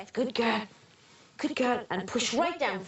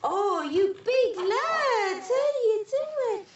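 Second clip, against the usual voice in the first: higher, laughing, amazed; laughing amazement; 9 semitones higher, slower than usual. Real, not acted. Midwife marvelling at the big baby, laughing.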